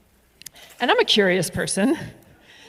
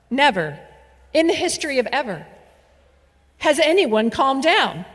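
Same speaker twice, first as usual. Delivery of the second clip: ordinary talk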